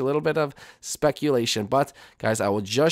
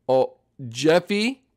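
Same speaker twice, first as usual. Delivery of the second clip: ordinary delivery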